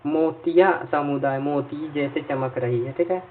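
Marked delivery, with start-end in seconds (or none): none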